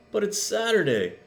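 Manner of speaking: put-on high child's plea; high pleading little brother; brother calling. A narrator's reading. Little brother calls after Tilly, high and pleading. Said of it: "her brother called".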